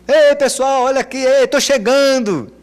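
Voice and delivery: high, loud drawn-out call. Retold or imitated. Pastor announcing arrival at the farm, loud call. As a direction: holler to the farmhouse. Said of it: "Dava aquele grito".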